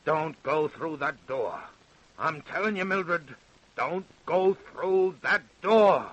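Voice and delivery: rising menace to a loud final order; threatening. Acted. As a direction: warn her off, building to a shout. Father threatens daughter at door.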